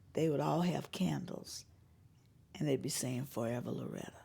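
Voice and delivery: whispered reverent vigil picture; hushed reverent. Real, not acted. Singer describing a candlelight tribute, whispered reverence.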